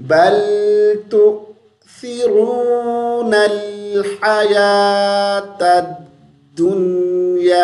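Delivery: slow chant, तुसिरूनल with a th for स; tongue-out th recitation. Shown as a demonstration. Teacher models tongue position, slow chant with th in तुसिरूनल. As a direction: recite with long held vowels, th in तुसिरूनल. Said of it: "ज़ुबान को बाहर निकाल के".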